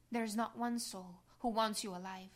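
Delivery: ordinary talk, nothing marked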